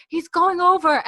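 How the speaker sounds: high, loud mock alarm; Claire. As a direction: call out an urgent warning. Repeats Claire's alarmed cry.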